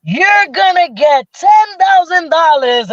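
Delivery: high, drawn-out game-show host cry; prize announcement. Retold or imitated. Host announcing her cash prize, drawn-out excitement.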